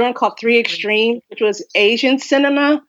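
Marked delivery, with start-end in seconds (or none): none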